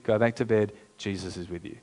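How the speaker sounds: put-on a worn-out mum sending the kid back to bed; hushed, sleepy brush-off to a scared child; weary parent. Weary parent sends son back.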